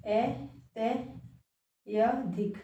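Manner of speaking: अत्यधिक broken into ए, ते, या धिक with pauses; spelled out syllables. Shown as a demonstration. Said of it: "शुद्ध उच्चारण जो है पता होना चाहिए".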